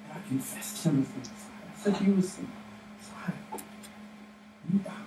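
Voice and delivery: hushed, faltering disbelief; Peter in disbelief. Acted. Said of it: "you imagine Peter".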